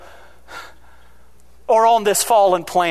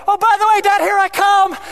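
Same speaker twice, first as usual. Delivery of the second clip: put-on much higher, louder, breezy child's voice; carefree kid mid-jump; 10 semitones higher, 5 dB louder than usual. Child leaping off the platform calls to dad, high carefree kid voice. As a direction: play the kid tossing it off while flying through the air. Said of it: "they just do the old".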